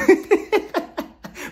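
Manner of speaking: high breathy laugh. Real, not acted. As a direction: burst out laughing. Laughing at Courtney.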